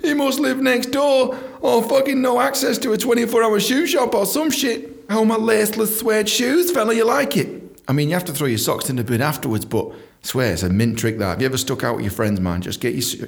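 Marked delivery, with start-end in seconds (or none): 0.0-7.8 high, slow mock bouncer voice; mock impressed staff. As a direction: play the fooled doorman, awed and slow. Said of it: "they just think".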